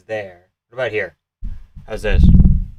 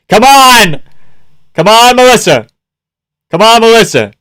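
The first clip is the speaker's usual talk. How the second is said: loud, raised pleading shouts; urgent cheering; 9 semitones higher, 6 dB louder than usual. Real, not acted. Fan urging on the fighter, urgent shouting. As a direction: holler to urge your fighter on.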